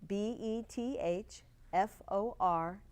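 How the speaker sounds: spelled out very slowly, letter by letter; slow, deliberate dictation with space between letters; slow spelling. Shown as a demonstration. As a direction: spell it nice and slowly for listeners. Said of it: "repeat it nice and slowly".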